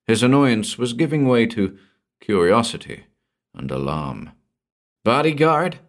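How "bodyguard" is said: higher, surprised exclamation; exclaimed. A narrator's reading. Nephew hearing of the bodyguard, alarmed exclamation. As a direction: blurt it out in alarm. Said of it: "he exclaimed".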